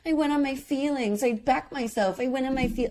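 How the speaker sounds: high-pitched mocking imitation of her; mocking quote. Host mimics the contestant's excuses, high mocking voice. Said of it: "'cause she's like".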